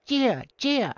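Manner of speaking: high-pitched hype man yelp; Joey C. Acted. Hype man backing up his boss, high squealed ad-lib. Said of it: "And Joey C goes".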